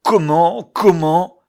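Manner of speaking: high, loud yelps of indignation; outraged yelping. A narrator's reading. Man dragging the intruder out, indignant yelping. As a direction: yelp each word in outrage. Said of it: "glapissait-il".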